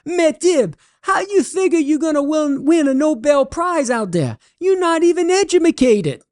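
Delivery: Cajun holler. Acted. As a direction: call out across the field in a high Cajun voice. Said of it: "he hollers".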